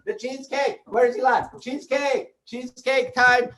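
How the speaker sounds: high, eager puppet pleading; a puppet who can't wait for cake; high puppet calling for cheesecake. Acted.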